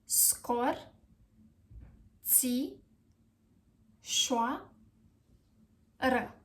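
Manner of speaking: Scor, ți, șoa, ră as isolated syllables; long gaps between Scor, ți, șoa and ră. Shown as a demonstration. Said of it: "How are we going to pronounce this word?".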